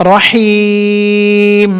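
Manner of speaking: রহিম drawn out, stopping on a held মিম; pausal form. Shown as a demonstration. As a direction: stretch রহিম slowly, end on a still m. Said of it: "উচ্চারণ হবে". (রহিম ধীরে টেনে, মিম সাকিন)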